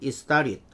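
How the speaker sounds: "It started" quickly linked as "istarit"; native-speaker pronunciation. Shown as a demonstration. Teacher repeating the native reading for learners, linked and reduced. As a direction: drop the t's in "It started", flap into "i-starid". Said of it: "다시 한번 해볼게요".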